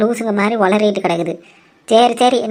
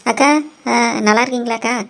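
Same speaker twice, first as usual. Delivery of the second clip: ordinary talk, nothing marked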